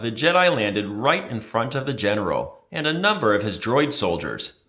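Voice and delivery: usual speaking voice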